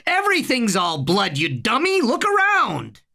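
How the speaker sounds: high, tinny, exasperated yell; angry shout. A narrator's reading. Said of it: "Harry shouted at him".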